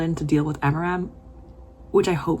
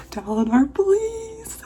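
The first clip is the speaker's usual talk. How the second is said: 1 tearful plea; much slower than usual. Real, not acted. Pleading again, voiced.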